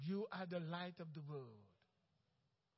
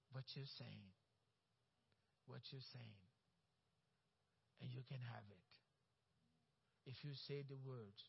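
hushed emphasis; 9 semitones lower, 11 dB quieter than usual. Real, not acted. Intense hushed emphasis in sermon.

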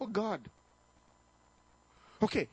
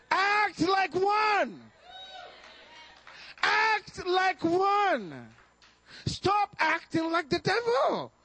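preaching shout; 9 semitones higher, much slower than usual. Real, not acted. Preacher shouting slowly at the congregation. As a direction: drive each word home to the church.

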